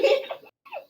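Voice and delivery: a sudden high burst of laughter; real laugh. Real, not acted. Streamer laughs at game moment.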